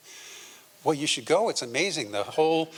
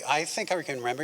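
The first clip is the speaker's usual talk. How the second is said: ordinary talk, nothing marked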